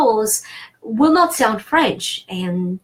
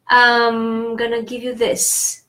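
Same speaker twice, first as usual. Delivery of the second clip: ordinary talk, nothing marked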